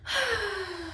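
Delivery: high airy sigh; falsetto sigh. Shown as a demonstration. Teacher sighs to find the falsetto. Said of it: "si je soupire".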